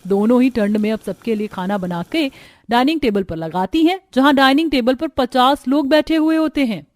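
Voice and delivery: usual speaking voice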